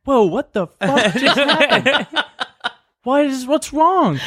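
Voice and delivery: high, slow, bewildered whoa; stunned confusion. Retold or imitated. Roommate voicing the room's confusion at the sobbing, high slow bewilderment. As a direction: drag it out, baffled and alarmed.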